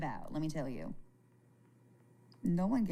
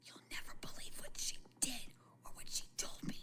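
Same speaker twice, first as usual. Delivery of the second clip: soft conspiratorial whisper, much softer; gossiping whisper; 4 semitones higher, 9 dB quieter than usual. Retold or imitated. Imitating an internet gossip passing on a rumour, whispered. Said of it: "somebody could say".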